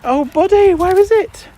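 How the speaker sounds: very high, loud squeal at the dog; excited squeal. Real, not acted.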